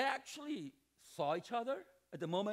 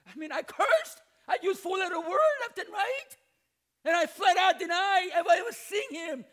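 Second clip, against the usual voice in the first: louder and higher, building to a shout; worked-up confession; 8 semitones higher, 9 dB louder than usual. Acted. Peter confessing his denial to the therapist, rising to a shout.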